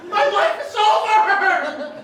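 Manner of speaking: high, slow panicked wail; panicked driver. Retold or imitated. Driver in his truck after the crash, high panicked wail. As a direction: wail it like your life just ended.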